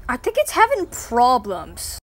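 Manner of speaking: ordinary delivery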